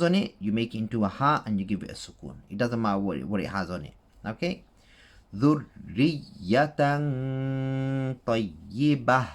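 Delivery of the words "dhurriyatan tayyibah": tayyibah ends in ha on the pause; slow Quranic recitation. Shown as a demonstration. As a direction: Dhurriyatan tayyibah drawn out, closing on ha.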